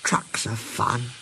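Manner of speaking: slow, soft, wistful musing; quiet, longing engine voice. A narrator's reading. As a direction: dwell softly and slowly on the words. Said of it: "he went on wistfully".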